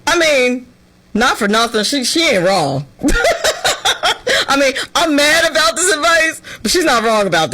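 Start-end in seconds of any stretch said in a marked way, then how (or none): 2.9-4.4 brief high giggle; high laugh. Real, not acted. Conceding the TikToker has a point, high giggle. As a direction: laugh at admitting it. Admitting the advisor is right.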